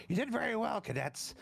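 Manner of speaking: pompous pat on the back for the cadets; officer praising. Acted. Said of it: "And he goes".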